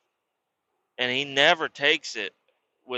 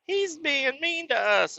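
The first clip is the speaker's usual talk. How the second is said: put-on high, slow childish whine; whiny little brothers; 14 semitones higher, slower than usual. Little brothers tattling to their dad, high childish whine. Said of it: "they're like".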